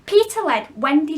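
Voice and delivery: plain reading voice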